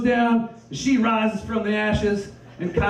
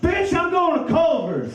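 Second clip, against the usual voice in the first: cocky Brady; 10 semitones higher than usual. Retold or imitated. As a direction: toss it off like a cocky star. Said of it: "He's just like".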